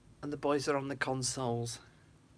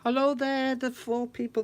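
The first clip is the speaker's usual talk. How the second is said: much higher and louder greeting; cheery loud hello; 8 semitones higher, 7 dB louder than usual. Real, not acted. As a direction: call out to the viewers brightly. Greets the four viewers.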